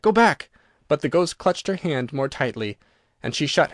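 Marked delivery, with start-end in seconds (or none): 0.0-0.5 higher, louder cry; warning shout. A narrator's reading. Tapestry huntsmen crying out to Virginia, loud and urgent. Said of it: "they cried".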